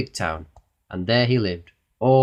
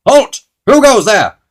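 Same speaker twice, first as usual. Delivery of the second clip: high, very loud challenge; goblin sentry's cry; 12 semitones higher, 15 dB louder, slower than usual. A narrator's reading. Goblin sentry challenging visitors at the palace, high shout. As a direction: shout it like a guard at the gate. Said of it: "cried the goblin sentry at the gate".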